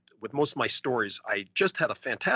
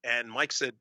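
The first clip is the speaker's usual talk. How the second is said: usual speaking voice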